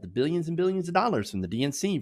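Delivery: ordinary delivery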